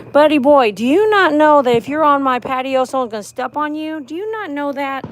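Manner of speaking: high, loud mock scolding of a tiny snail; talking to a snail. Real, not acted. Owner scolding a snail on her patio, high teasing voice.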